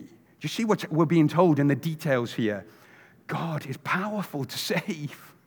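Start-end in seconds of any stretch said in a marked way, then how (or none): none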